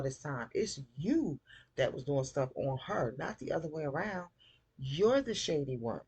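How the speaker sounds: high, exasperated telling-off; worked-up, disapproving rant; indignant scolding. Real, not acted. Scolds the show's characters directly.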